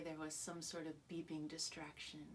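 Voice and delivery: normal talking voice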